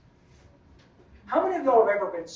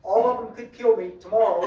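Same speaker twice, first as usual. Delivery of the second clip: ordinary talk, nothing marked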